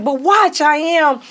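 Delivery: high, defiant; teen answering friends who doubt her, high and sure; playful insistence. Retold or imitated.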